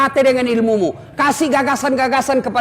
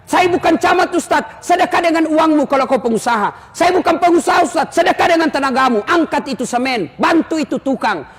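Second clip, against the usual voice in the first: whiny excuse-maker; 6 semitones higher than usual. Acted. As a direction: plead your excuses to the preacher. People giving excuses to the preacher.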